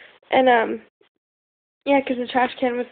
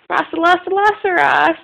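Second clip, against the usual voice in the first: calling out; 6 semitones higher, 5 dB louder, much slower than usual. Retold or imitated. Calling friend's name playfully.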